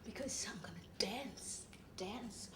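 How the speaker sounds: very soft, low, half-whispered; drunken murmur. Acted. Drunk alone and going to dance, whispery. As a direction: let it sink almost to a whisper.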